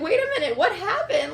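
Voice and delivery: high, loud indignant retort; indignant protest. Retold or imitated. Wife retelling how she confronted her blaming husband, indignant.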